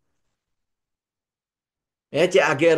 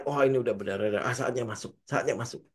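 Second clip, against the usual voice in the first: low, hushed imitation of a trader rushing in; mock the overeager buyer in a hushed voice; mock eager trader; 6 semitones lower, 6 dB quieter than usual. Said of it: "jangan bikin subjektivitas kita sendiri". (meniru trader yang buru-buru masuk, suara rendah dan pelan)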